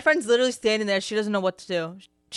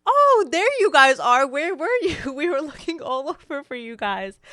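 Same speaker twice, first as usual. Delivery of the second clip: falsa surpresa; 5 semitones higher than usual. Retold or imitated. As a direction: gush as if you'd been looking for them all along. Caught sneaking out, fakes relief.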